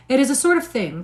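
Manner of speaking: emphatic indignant outburst; emphatic cry. A narrator's reading. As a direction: insist hard, a little offended. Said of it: "cried Missus Elton emphatically".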